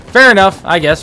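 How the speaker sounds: high, loud sarcastic "Fair enough". Real, not acted. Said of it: "I'm saying that sarcastically".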